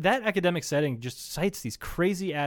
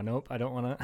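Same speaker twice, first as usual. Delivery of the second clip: ordinary delivery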